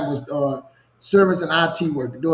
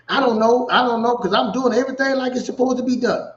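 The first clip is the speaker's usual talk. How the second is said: higher, insistent, half-pleading protest; imitating his younger defensive self; 8 semitones higher than usual. Telling the supervisor he does everything right, in a higher, defensive imitation. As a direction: replay your old self swearing you did nothing wrong.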